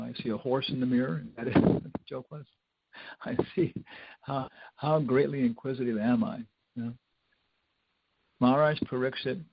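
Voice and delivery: normal talking voice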